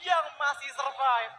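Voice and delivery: loud, high hyped caster call; fighting game announcer. Acted. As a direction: shout the play like a finals caster.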